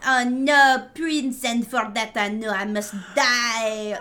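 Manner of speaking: loud, hammed-up French accent; bad French accent. Imagining a Hollywood actress as Cinderella, mock French accent. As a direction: overact wildly in a terrible French accent. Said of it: "having them be like ... doing a French accent".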